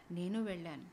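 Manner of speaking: ordinary delivery, nothing marked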